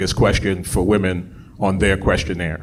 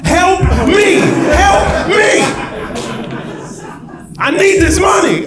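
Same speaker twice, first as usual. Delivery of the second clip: desperate shout; 5 dB louder, slower than usual. Retold or imitated. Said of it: "they're like".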